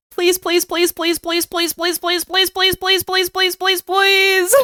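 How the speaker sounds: high, quick run of playful pleases; joking last argument by begging; rapid mock begging. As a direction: rattle off the pleas like a kid nagging. Third reason is endless begging.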